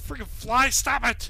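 sharp frustrated yell at a fly; annoyed shout. Real, not acted. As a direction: snap at the buzzing fly.

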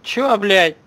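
loud, high, incredulous yelling with a laugh; outraged yelling. Real, not acted. Streamer shouting at the dead-talking mother, outraged. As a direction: yell at the screen in disbelief.

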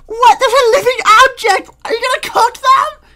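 very high, crying cartoon lady; panicking Screen Lady. Screen Lady panics about making pizza, high crying wail.

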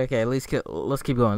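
ordinary talk, nothing marked

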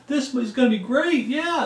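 high, gushing, naive excitement; hammed-up giddy enthusiasm; mock eager newcomer. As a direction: play the starry-eyed new member, pitched well up. Mocking eager newcomers joining church.

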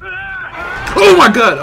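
real fright at a jump scare; startled scream. Real, not acted. Gamer jolted by a monster, screaming in fright.

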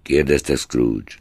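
ordinary talk, nothing marked

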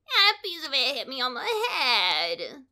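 a touch of crying; tearful complaint. A narrator's reading. Chicken Little tells the officer, mildly tearful. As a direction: whimper it out. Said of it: "added Chicken Little".

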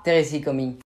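ordinary talk

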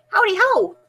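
high, sing-song hello; cheery greeting. A narrator's reading. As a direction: hop in with a high storybook greeting. Hare's greeting in story.

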